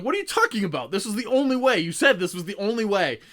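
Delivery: high, loud indignant outcry; exasperated protest. Retold or imitated. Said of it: "everyone else is like".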